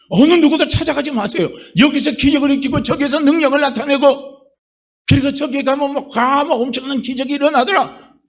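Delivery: high and loud, pleading preaching; a pastor loudly warning against chasing false miracles; fervent warning sermon. Acted.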